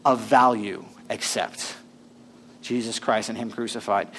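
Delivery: hushed, slow paraphrase of "except Jesus Christ and him crucified"; slow and quiet. Shown as a demonstration. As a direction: let it sink in gently. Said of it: "The other way to say this statement".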